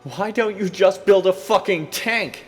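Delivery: very high, loud scornful yell; furious cry. Acted. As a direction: scream it in disgust. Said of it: "I cried".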